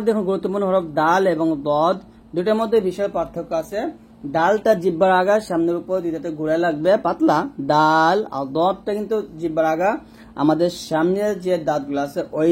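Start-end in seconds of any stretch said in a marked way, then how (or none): none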